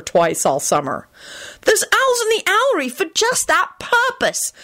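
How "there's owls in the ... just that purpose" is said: put-on high, know-it-all child's voice; lofty. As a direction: say it superior. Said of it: "Timothy stated loftily".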